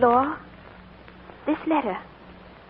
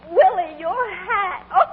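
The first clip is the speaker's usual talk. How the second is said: gasp, then higher; stifling a laugh at his hat; amused shock; 7 semitones higher, slower than usual. Acted.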